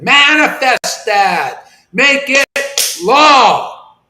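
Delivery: slow, commanding declaration, lean on every word; authoritative proclamation. Real, not acted. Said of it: "Wow, that sounded authoritative".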